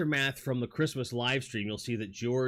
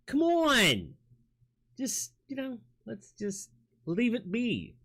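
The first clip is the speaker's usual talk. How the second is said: high, pleading cry that slows right down; mock exasperated plea; 11 semitones higher, slower than usual. Streamer begging a rhyming chatter to stop, high and hammed-up. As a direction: beg him to drop it, hammed up.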